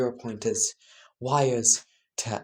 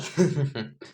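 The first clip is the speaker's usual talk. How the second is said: gleeful laugh; 4 semitones higher than usual. Acted.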